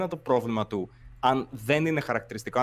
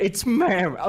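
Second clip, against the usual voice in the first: imitating an indignant correction, higher; put-on outburst with raised pitch; mock outraged customer; 5 semitones higher than usual. Echoing the person from a known incident, mock outraged. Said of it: "εκείνο το περιστατικό που έλεγε".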